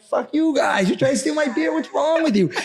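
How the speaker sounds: angry yell back. Retold or imitated. Retold yelling back at thieves.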